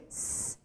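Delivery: S, S said as a long voiceless hiss; hissed S sound. Shown as a demonstration. Teacher showing the S sound to students. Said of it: "S, C".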